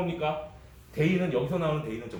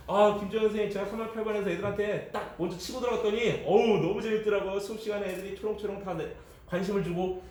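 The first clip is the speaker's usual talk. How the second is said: higher-pitched, chatty enthusiasm; mildly excited teacher; 6 semitones higher than usual. Acted. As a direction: act a teacher eagerly telling a colleague. Acting a teacher delighted by class.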